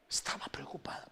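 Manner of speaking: whispered aside; preacher whispers the point to the congregation. Acted. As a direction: breathe it as a whisper.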